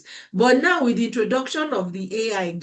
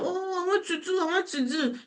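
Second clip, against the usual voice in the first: mock eager whine; 8 semitones higher, 6 dB quieter than usual. Imitating eager people.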